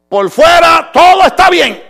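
loud, high preaching shout. Acted. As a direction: proclaim the clown's happy face. Preacher shouting clown image. (gritando en voz alta)

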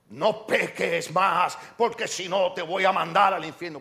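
slow, hammering "No peques más", then the threat; mock stern threat. Preacher shows how Christ did not address the woman, mock threat. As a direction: play a menacing scolding, drawn out and emphatic. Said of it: "Cristo no le dice a la mujer".

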